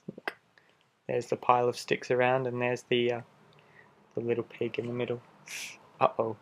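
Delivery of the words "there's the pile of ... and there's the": ordinary delivery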